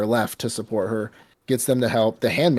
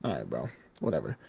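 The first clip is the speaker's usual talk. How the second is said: dismissive mumble; 9 semitones lower, 12 dB quieter, faster than usual. Retold or imitated. Retelling his own dismissive reaction.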